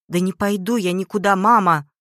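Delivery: loud, defiant refusal; crying out. A narrator's reading. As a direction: shout back at mother, refusing. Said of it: "закричала Прасковья". (отчаянно кричит в ответ)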